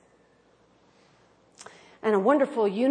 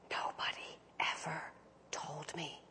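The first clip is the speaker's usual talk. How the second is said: breathless whisper; whispered through tears; 6 semitones lower, 18 dB quieter than usual. Retold or imitated. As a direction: barely get the words out. Condemned man weeping, quoted.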